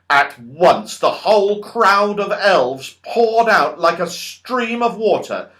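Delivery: ordinary delivery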